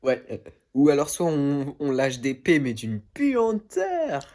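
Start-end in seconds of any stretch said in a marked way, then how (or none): 2.6-4.3 exaggerated disgust. Dégoût exagéré sur l'odeur.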